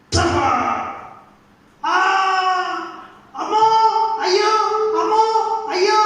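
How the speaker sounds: sharp panicked cries of ouch and oh no; pained yelps. Acted. Boy stung by bees, yelping in pain. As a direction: yelp as the stings hit.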